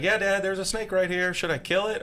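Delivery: put-on high, eager little boy voice; excited kid. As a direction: play the eager seven-year-old son. Son voiced in snake story.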